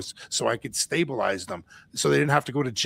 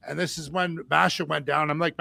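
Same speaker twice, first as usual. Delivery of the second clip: usual speaking voice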